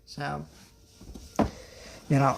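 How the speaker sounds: usual speaking voice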